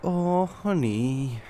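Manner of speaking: slow, drawn-out mock-sweet honey. [name] teasing Winter with a drawn-out, mock-sweet honey. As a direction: patronise him sweetly and slowly.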